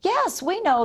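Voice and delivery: high cheerful group shout; a chorus of donuts calling out happily; shouting back. A narrator's reading. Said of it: "the donuts shouted back".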